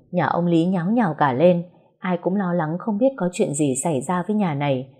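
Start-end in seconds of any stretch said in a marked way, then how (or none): none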